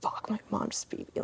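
muttered under breath, unvoiced; whispered. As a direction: whisper it in dismay. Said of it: "I'm like".

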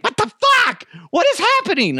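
very high squeaky cat voice; high squeaky voice of a confused cat; voicing the cat. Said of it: "looking around like".

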